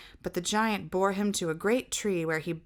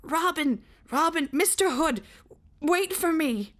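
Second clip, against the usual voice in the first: higher, louder desperate call after the archers; hoarse calling; 8 semitones higher, 3 dB louder than usual. Acted. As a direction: call after them as they vanish into the dark. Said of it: "calling hoarsely".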